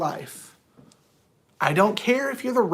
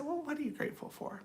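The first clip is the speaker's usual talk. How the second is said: soft, higher-pitched question; gentle prompting; 8 semitones higher, 14 dB quieter, faster than usual. Retold or imitated. Counselor to an anger management client, gently prompting. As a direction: ask it carefully and very softly.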